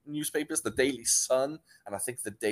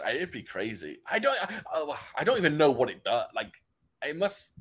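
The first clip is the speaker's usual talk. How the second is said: ordinary talk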